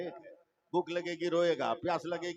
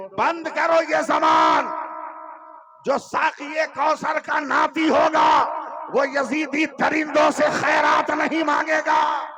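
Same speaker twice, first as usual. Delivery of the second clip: slow, high, loud roar of defiance; defiant shout; 8 semitones higher, 12 dB louder, slower than usual. Acted. Husain rebuffing the Yazidis, thundering defiant shout. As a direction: hurl it back at the enemy.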